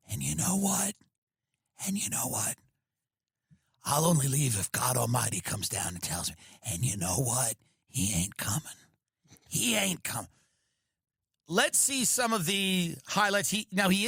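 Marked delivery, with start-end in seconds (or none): 0.1-10.3 soft, lower, pushed-close mic whisper imitating Biden; forceful whisper. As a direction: lean into the mic and hiss it. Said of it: "the forceful whisper where he goes".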